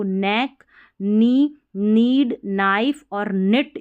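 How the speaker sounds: नेक नी नीड नाइफ said starting straight on N; each word starting cleanly on N; silent K, correct. Shown as a demonstration. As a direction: model the right way, no K at all. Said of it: "उसको न पढ़ना है".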